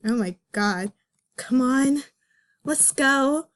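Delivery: ordinary talk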